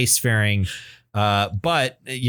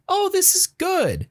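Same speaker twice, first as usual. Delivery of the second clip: high, airy casual approval; mild approval; slower than usual. Retold or imitated. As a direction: toss it off as mildly pleased. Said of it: "I'm always like".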